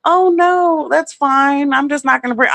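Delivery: mock the sweet pushover she refused to be; meek backing down. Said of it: "I wasn't being like".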